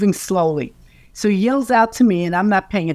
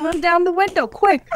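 much higher, urgent command; urgent excited; 11 semitones higher than usual. Retold or imitated. Telling John to roll down the window, urgent and excited. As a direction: hurry him up, pitch jumping high. Urging John to call Paul.

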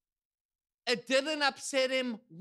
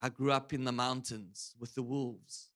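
low, soft, deadpan imitation; dead-serious quote, low and quiet; totally serious; 11 semitones lower, 6 dB quieter than usual. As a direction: say it gravely, dropped right down in pitch. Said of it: "like, totally serious".

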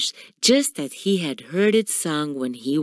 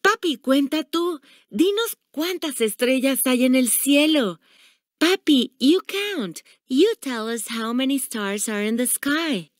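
high-pitched eager pleading, no real yell; excited children's shout; 7 semitones higher than usual. A narrator's reading. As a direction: call out like children begging grandpa. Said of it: "they shouted".

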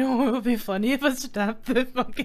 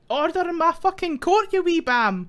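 put-on glasgow street accent; 5 semitones higher, 7 dB louder than usual. Scottish judge outburst.

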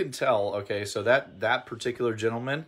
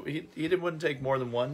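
usual speaking voice

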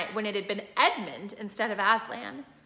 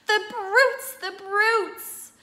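higher, louder weeping cry; tearful outrage; 10 semitones higher, 6 dB louder, much slower than usual. A narrator's reading. Lucy weeping at the brutes, higher pitched. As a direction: sob out the insult. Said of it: "tears streaming down her cheeks".

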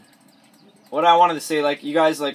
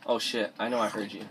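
usual speaking voice

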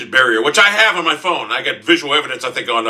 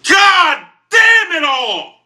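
loud, high, long-drawn yell; slow howl of frustration; frustrated scream; 8 semitones higher, 5 dB louder, much slower than usual. Real, not acted. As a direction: stretch each cry out in exasperation. Gamer screams after losing.

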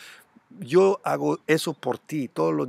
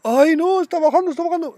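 much higher, louder hammed-up wail; mock panic; 13 semitones higher, 7 dB louder, faster than usual. Vlogger mocking buyers panicking as the price drops, high whiny mock wail. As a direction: whine it like a buyer losing money.

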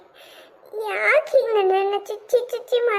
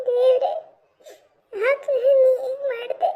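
sobbing reproach to God, pitched up; tearful lament; 4 semitones higher than usual. Acted. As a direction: cry it out in broken phrases with long pauses. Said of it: "ಅಳ್ತಾ ಇದೀಯಾ?".

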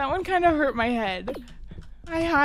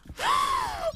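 sharp gasp. Real, not acted. Spotted by a seeker.

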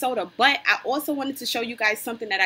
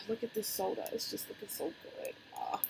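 whispering; 11 dB quieter than usual. Real, not acted. Admiring the soda.

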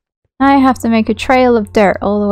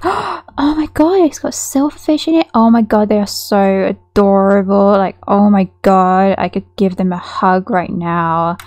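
gushing delight; 3 dB louder than usual. Acted. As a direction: gush as if you just spotted something adorable. Acting out visitor seeing silverfish.

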